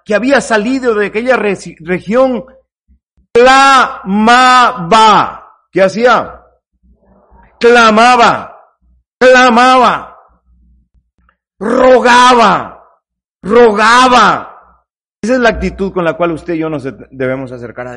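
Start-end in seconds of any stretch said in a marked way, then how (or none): 3.3-4.9 drawn-out cry. Acted. Preacher dramatizes the woman's cry. 7.6-14.4 slow, louder, drawn-out words; preacher crying out slowly; slow pleading cry. Acted. Preacher dramatizes the woman's crying out.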